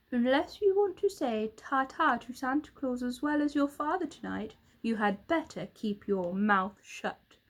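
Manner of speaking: usual speaking voice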